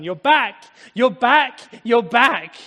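loud, high, jubilant shouts; overjoyed father. Acted. Father embracing his returning son, overjoyed shouting.

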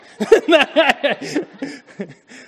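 high, loud burst of laughter. Real, not acted. Laughing at own joke.

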